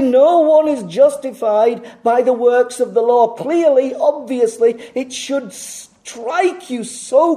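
loud, high, ringing pulpit delivery; preaching at full force. Acted. Preacher hammering clarity to his flock, loud and high. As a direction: hammer the point home to the congregation.

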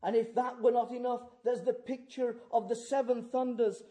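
high, loud, thundering sermon; full-force preaching. Real, not acted. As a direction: proclaim it with the force of a lion's roar.

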